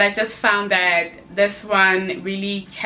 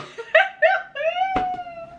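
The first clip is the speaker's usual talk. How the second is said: very high, squealing giggle; high squealing laugh. Real, not acted. After confessing she loves men again, high squealing laugh. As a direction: let a high giggle escape.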